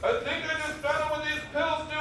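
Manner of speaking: as if your stomach just turned on you; hammed-up panicked discovery; alarmed realization. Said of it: "he was like".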